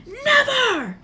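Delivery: very high furious shout; Harry refusing to hand over the stone; defiant shout. A narrator's reading. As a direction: scream your refusal.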